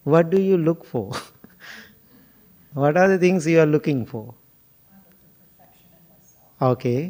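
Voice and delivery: ordinary delivery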